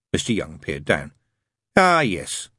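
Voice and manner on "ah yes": higher, doubtful Ah, yes. A narrator's reading. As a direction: agree with doubt in your voice. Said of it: "he said doubtfully".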